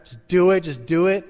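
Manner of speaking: loud, pushy slave-driving order; slave driver. Acted. Harsh parent driving the kids to work, pushy. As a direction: bark it like a demanding parent. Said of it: "you become a slave driver".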